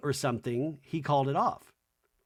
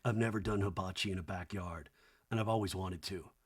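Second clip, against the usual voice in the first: Jesse's voice; 6 semitones lower, 8 dB quieter than usual. Retold or imitated. Jesse voiced low and soft.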